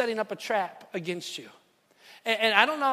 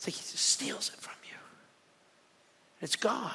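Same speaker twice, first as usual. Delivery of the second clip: hushed, breathy whisper of theft; 3 semitones lower, 8 dB quieter than usual. Acted. Preacher whispering to the congregation about the thief. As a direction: drop to a secret whisper.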